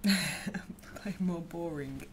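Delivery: slow breathy whisper. Real, not acted. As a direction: whisper it slowly under your breath. Whispered chat question mid-stream.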